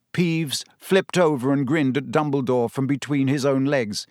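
ordinary delivery, nothing marked

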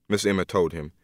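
usual speaking voice

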